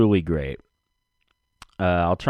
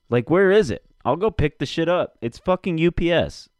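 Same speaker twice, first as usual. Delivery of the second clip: frustrated rant; 8 semitones higher than usual. Real, not acted. Ranting about late package.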